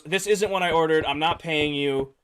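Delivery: ordinary talk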